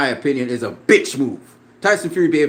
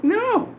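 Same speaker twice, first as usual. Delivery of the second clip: a real burst of laughter; amused laugh; 9 semitones higher than usual. Real, not acted. Laughing off a critic he says he doesn't care about.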